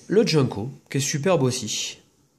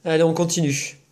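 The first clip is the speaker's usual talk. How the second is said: ordinary talk, nothing marked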